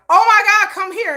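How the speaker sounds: high, loud oh my God; a mother thrilled, calling her child over; excited call. Retold or imitated. Mother calling daughter to the TV, high loud excitement.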